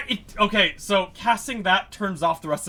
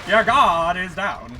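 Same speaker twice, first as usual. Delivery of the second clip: louder, slow, grandiose proclamation; booming pompous voice; 5 semitones higher, 7 dB louder, slower than usual. Acted. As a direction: boom it out like a gloating emperor. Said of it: "pompous ass voice to a bunch of spiders".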